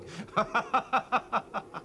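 a high laugh that falls away; laugh, rising high then trailing down; high falling chuckle; amused laugh. Real, not acted. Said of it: "What was all the laughing for?".

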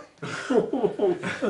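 a bright, delighted burst of laughter; real laugh of pleased surprise; laughing with joy at the invitation; happy laugh. Real, not acted.

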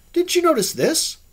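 high-pitched, loud and eager; eager question. Acted. Man showing off his skylight to his visitor, excited. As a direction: ask it with excited urgency.

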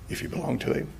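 hushed repeat, breathed with no voice; whisper. Acted. Preacher reassures the congregation, intimate whisper. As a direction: lean in and whisper it to them.

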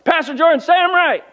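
high, pleading spouse voice; a husband begging the pastor to take his side; whiny spouse. Retold or imitated.

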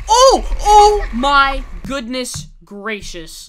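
loud, stretched "oh my goodness gracious"; shocked. Real, not acted. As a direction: gasp it out, drawn out. Shocked by surf clip.

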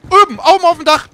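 high, loud urgent callout; urgent shout. Real, not acted. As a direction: yell it to warn your teammate. Shouted callout spotting enemy.

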